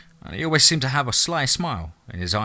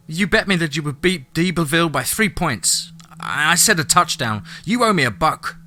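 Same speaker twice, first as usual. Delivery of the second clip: put-on higher-pitched, indignant boy; insistent kid brother; 6 semitones higher, 3 dB louder than usual. A narrator's reading. Boy arguing over a bet with Lance, insistent. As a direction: argue the case in a raised child's pitch.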